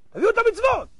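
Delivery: high-pitched acted order; court command. Heavenly court orders deeds brought.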